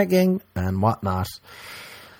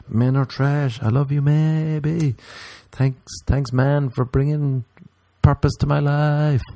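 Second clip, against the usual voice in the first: higher, slow sugary mock gratitude; mock gushing woman; 6 semitones higher, slower than usual. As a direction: swoon over the man in a pitched-up voice. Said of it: "mock woman continues".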